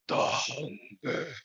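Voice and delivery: a looming beast savouring its threat; monstrous hyena voice. Acted. Giant two-headed hyena looks down and pronounces doom on the dreamer.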